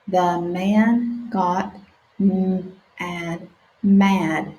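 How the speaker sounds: stretched-out sounding out; slow sounding out. Shown as a demonstration. Teacher reading slowly with children. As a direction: read each word slowly for beginners. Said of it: "let's practice together".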